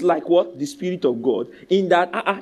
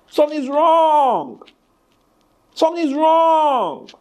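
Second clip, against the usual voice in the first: high, slow, drawn-out warning; slow, emphatic warning; 9 semitones higher, 3 dB louder, much slower than usual. Acted. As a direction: lean on wrong, slowly. Repeated in a slow, rising build.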